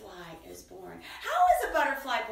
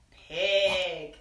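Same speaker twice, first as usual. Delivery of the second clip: ordinary delivery, nothing marked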